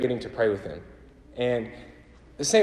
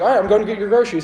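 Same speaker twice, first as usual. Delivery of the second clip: relieved rush; 9 semitones higher, 9 dB louder, much faster than usual. Retold or imitated. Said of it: "I was like".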